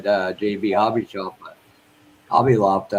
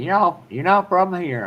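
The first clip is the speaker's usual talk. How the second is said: quoted store lady; 9 semitones higher, 5 dB louder than usual. Retold or imitated. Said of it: "the lady looked at me".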